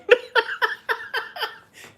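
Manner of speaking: loud, high burst of laughter; real high laugh; big laugh. Real, not acted. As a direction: crack up at the joke. Reacting to a joke.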